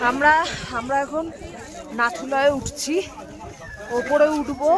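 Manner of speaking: calling out in the cold; loud. Real, not acted. Calling out on the windy pass.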